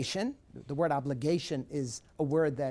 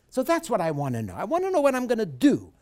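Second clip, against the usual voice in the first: ordinary delivery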